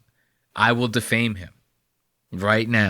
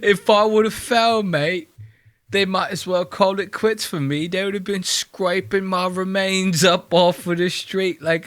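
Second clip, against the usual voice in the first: higher, hammed-up British whine; mock British accent; 7 semitones higher than usual. Mocking the friend who nearly fell, high British accent. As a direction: camp it up like a flustered Brit. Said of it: "Look at how embarrassed he is".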